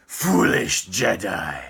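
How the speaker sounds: slow, sinister Sith-lord sneer; deliberate evil overlord taunt; villain voice. Pretend. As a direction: purr it like a gloating dark lord. Villain line after laughing.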